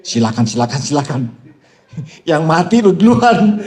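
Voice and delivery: laughing tease; laughing mock permission. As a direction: grant it with amused, teasing laughter.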